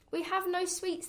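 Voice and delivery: high little ant voices; tiny ants. A narrator's reading. Ants answering the highway rat, high and small. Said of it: "the ants replied".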